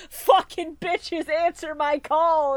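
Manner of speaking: slow, drawn-out hero's command; mock summons. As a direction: call it out slowly, stretching each word. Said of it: "he's just like".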